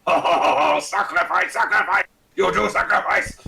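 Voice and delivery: high, loud cackling demand for sacrifice; a spirit in the head crowing for blood; evil voice inside the dagger crying sacrifice; gleeful dagger voice. Acted. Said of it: "the voice in the head going".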